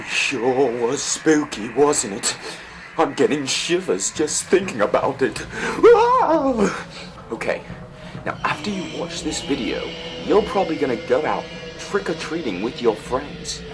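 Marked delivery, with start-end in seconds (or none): none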